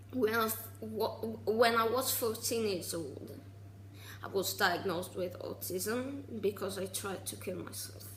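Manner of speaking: ordinary talk, nothing marked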